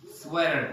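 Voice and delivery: "sweater" said like "swedder"; American flapped T in "sweater"; T as a soft D. Shown as a demonstration. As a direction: tap the T in "sweater" and sound the R. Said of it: "change it into D sound".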